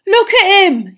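high, loud outraged shout; furious. A narrator's reading. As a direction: shout it as an accusation. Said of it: "he said furiously".

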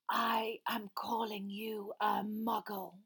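slow, whispery cool villain. A narrator's reading. Master answering Frank's challenge, cool whispery voice. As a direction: spell it out coolly and slowly. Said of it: "said the voice coolly".

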